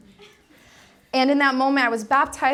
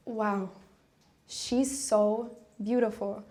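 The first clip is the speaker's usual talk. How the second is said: hushed awe; 2 semitones lower, 6 dB quieter, much slower than usual. Retold or imitated. Acted awe at seeing a sister.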